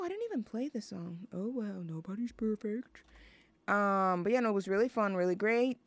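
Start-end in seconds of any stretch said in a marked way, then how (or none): 0.0-3.0 much softer, fast muttering; under the breath; muttered aside. Real, not acted. Muttered aside about missed song.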